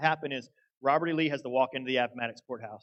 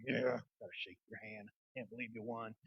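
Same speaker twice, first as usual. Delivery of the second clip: mock grudging; 4 semitones lower, 12 dB quieter than usual. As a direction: play a sore loser conceding under their breath. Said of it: "some people might be mad and".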